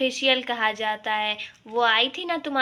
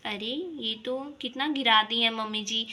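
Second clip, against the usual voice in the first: usual speaking voice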